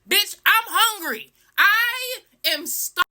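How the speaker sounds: high, whiny exasperation; dramatic, high-pitched complaint; hungry outrage. Retold or imitated. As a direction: wail it in hammed-up indignation. Complaining about long wait, acted out.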